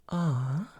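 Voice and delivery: thin, airy "ah"; light vocal weight, soft; soft, breathy ah. Shown as a demonstration. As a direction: ease into a light, breathy sound. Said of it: "softer sound".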